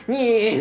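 high, loud "nyeh" noise; comic whiny scurrying cry; goblin scampering squeal. Acted.

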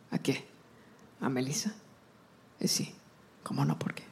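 put-on low, hushed old abuela gossip; great-grandmother gossiping about her granddaughter in Spanish, hushed; great grandma gossiping. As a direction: murmur it to the relatives, half hushed. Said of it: "my great grandma's voice".

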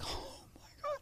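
breathless whispered gasp of shock. Retold or imitated. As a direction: whisper it as if the floor just dropped away. Shock at being caught.

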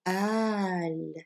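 wrong reading al, long drawn A; long-vowel aal. Shown as a demonstration. Teacher shows the wrong long-A al. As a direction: al with the A held long. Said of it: "why it's not pronounced".

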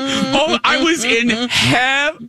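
high, drawn-out swoon of delight; blissful. Retold or imitated. Fan recalls being scolded by villainess, blissful.

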